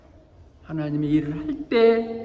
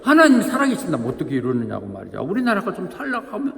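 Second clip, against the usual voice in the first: mock grumbling that builds higher; complaining; 6 semitones higher, faster than usual. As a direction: imitate a worked-up complaint, pitch climbing. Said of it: "막 불평하죠".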